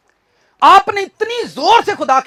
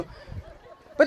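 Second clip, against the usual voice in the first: whisper; 11 dB quieter, much slower than usual. Retold or imitated. Whispered aside.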